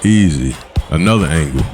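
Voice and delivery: normal talking voice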